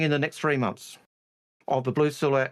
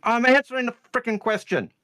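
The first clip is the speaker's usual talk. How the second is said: higher, irritated insistence; exasperated; 7 semitones higher than usual. Real, not acted. Host told to reread the question, exasperated. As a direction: protest as if at the end of your patience.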